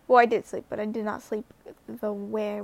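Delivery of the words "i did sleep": usual speaking voice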